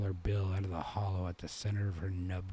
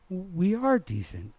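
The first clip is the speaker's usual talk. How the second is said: put-on high, loud indignant girl; sudden retort; 11 semitones higher, 6 dB louder than usual. A narrator's reading. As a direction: snap back, hurt and proud. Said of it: "Queenie said suddenly".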